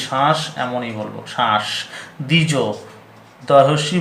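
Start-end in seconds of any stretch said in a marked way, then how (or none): 0.1-1.6 শ্বাস said as plain "shash", no b sound; pronunciation demo. Shown as a demonstration. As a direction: drop the ফলা and say শ্বাস low. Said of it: "আমরা কেমন বলবি?". (বফলা বাদ দিয়ে শ্বাস)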